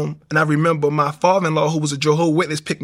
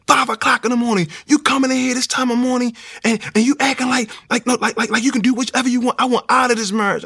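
higher-pitched mock wife scolding; upset wife voice; 7 semitones higher than usual. Wife scolding him for coming home at five, higher and exasperated. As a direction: raise the pitch and nag like a fed-up wife. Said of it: "She like".

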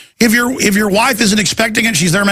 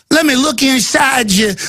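mock creepy screener; 7 semitones higher than usual. Mocking South Park style screener.

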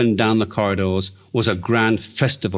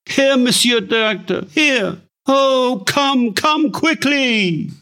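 frantic plea; 14 semitones higher, slower than usual. Acted. Frantic wife calling the director.

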